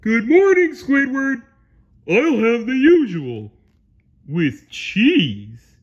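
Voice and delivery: loud, high, goofy cartoon voice; cheerful Patrick. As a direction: greet cheerfully at full volume. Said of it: "cheerfully said".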